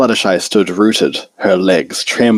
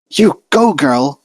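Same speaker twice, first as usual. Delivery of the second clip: high excited cheer; root for her under your breath; Twilight cheering; 8 semitones higher, slower than usual. A narrator's reading. Twilight cheering on her friend, high and excited.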